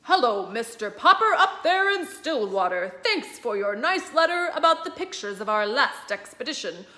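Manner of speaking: broadcast it from far away; admiral on radio. Acted. Admiral Drake greeting Mister Popper over the radio, announcer-like.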